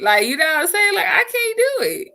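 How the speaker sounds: high, laugh-cracked voice. Real, not acted. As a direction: laugh while insisting. Laughing at the memory.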